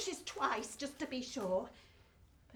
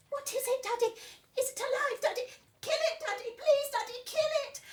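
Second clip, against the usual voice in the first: put-on much higher, pleading small child; little girl's voice; 10 semitones higher, 5 dB louder, faster than usual. Little Betsy begging her daddy, high and pleading. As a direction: beg high like an anxious little girl.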